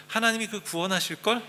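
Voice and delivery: high sarcastic taunt; mocking sneer. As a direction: sneer it like a mocker. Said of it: "이렇게 비꼬면서 조롱하는 거예요". (비꼬며 조롱하는 말투)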